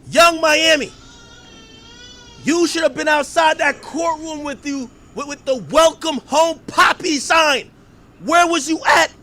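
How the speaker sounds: high, loud rant, slowing on the slogan; shouted scolding. Pretend. Scolding [name] for missing the courthouse, loud and high. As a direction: holler it like a public telling-off.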